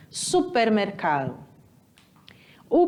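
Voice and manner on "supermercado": Supermercado with the final ado nearly swallowed; Supermercado, blur the ending ado together; Spanish pronunciation. Shown as a demonstration. Teacher showing students how Spanish sounds. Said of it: "vai quase suprimir o finalzinho".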